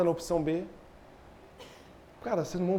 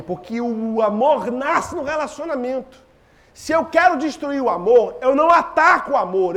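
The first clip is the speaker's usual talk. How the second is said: usual speaking voice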